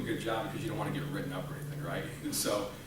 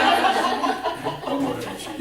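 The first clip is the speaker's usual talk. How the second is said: laughing; 12 dB louder, much slower than usual. Shown as a demonstration. Repeats question laughing.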